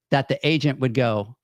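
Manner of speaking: ordinary delivery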